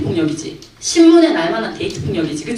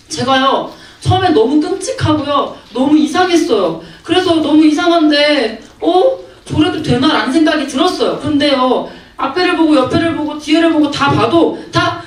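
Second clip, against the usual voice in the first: put-on higher-pitched girl recounting her experience; raised-pitch imitation of a girl; imitated young girl; 5 semitones higher than usual. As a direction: lightly play a schoolgirl explaining herself. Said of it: "그러니까 그 여자애가".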